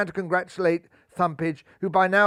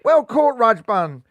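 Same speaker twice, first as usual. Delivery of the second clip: high, loud cheer; gleeful cry; 4 semitones higher, 6 dB louder, slower than usual. A narrator's reading. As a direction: cheer him like a teammate. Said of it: "cried his teammates with glee".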